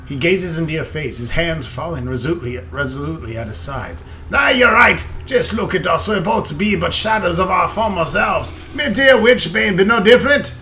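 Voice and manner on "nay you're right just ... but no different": loud, higher hearty dwarf. Acted. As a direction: boom it out like an old dwarf. Reads dwarf lines in character.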